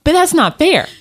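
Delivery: high-pitched imitation of an indignant daughter; mock squeal of protest, easing slightly; upset child. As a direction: whine back like an aggrieved kid. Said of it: "oh, she was upset".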